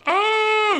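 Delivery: loud, very high wail; imitated baby cry. Parent mimicking son's morning cry, piercing wail. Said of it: "you hear him cry".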